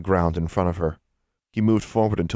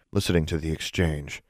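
ordinary delivery, nothing marked

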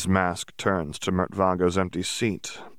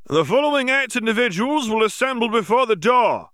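much higher, louder official announcement; announcing; 6 dB louder than usual. Acted. Berrycloth announces to the students, loud and high. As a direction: call the names out to the hall. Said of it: "Berrycloth announced".

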